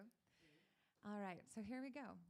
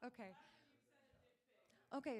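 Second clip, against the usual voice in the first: ordinary delivery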